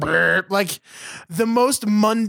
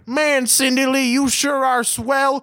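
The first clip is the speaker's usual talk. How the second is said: put-on old-timey teen boy; 5 semitones higher than usual. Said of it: "I was like".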